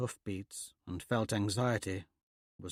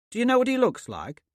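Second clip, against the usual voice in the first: Thraxa's voice; 12 semitones higher, 6 dB louder than usual. A narrator's reading. Thraxa questions the snipers.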